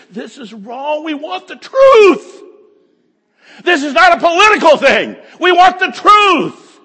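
high, loud, slow shouted declaration; shouted demand. Acted. Preacher shouting the words he wishes someone would say on the news. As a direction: cry it out like a man demanding the truth. Preacher voices an imagined bold answer.

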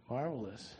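ordinary talk, nothing marked

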